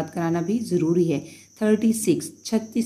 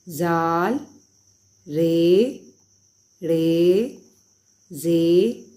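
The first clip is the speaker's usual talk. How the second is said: alphabet recitation; much slower than usual. Acted. Teacher reciting letters aloud for the class. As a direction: call out each letter for children to repeat.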